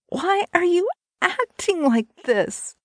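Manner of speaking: high-pitched, pleading woman's voice; distressed cry. A narrator's reading. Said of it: "she cried".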